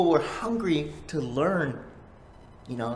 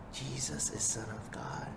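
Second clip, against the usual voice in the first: hushed whisper of "Jesus is Son of God"; 4 semitones lower, 9 dB quieter than usual. Shown as a demonstration. Preacher whispering the truth to the listeners, hushed. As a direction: breathe it as a secret. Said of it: "repeated as whisper".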